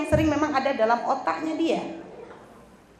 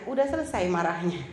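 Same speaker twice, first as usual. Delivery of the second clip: softly, lower, asking if he's done; a quiet, patient wife asking her husband; calm gentle question; 6 semitones lower, 4 dB quieter than usual. Retold or imitated. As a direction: coax him quietly. Calm wife asks angry husband.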